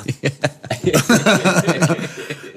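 high burst of laughter; real laugh. Real, not acted. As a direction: crack up at your own confession. After admitting the theft.

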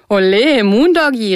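high, loud whoop; cheering call. A narrator's reading. Surfer cheering his friend on the board, high loud call. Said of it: "rief einer".

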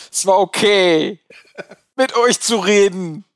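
slow, dry mock-solemn parody of the host; mock solemn quote. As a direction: quote him deadpan and slow. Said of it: "Wir schließen diesen Cast mit". (langsam, trocken spöttisch zitiert)

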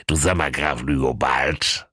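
a hint of a low king's voice; lightly voiced king. King asks the count, lightly voiced. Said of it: "sagte der König".

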